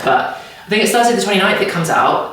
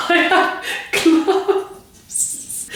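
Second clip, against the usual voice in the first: laughing; 12 semitones higher, much slower than usual. Real, not acted. Giddy over unboxed gloves.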